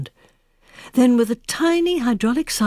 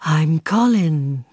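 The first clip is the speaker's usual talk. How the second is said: gentle robot voice; 4 semitones lower, much slower than usual. A narrator's reading. Robot greeting the old woman, slow and gentle. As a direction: let it drift out smooth and unhurried. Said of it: "so fluid and gentle it seemed to float".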